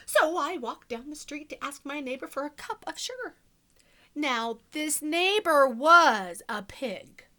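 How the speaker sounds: sly wolf narrator, hushed then punchy; storybook reading. A narrator's reading. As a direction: tell it to children as the wolf, confiding and playful. Continues the wolf's story.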